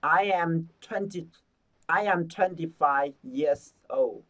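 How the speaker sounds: slow, word by word; slow full sentence. Shown as a demonstration. Teacher reading the whole sentence slowly for the class. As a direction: read it out slowly and clearly. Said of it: "chúng ta đọc hết cả câu nha".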